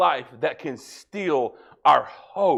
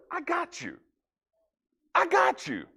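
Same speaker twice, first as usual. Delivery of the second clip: God reassuring; 12 semitones higher, 5 dB quieter than usual. Pretend. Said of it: "when God's going".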